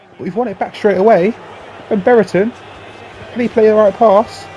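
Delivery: loud, high, rising play-by-play; excited commentary. Real, not acted. Gamer calling his goal like a commentator, excited. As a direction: build to the goal call.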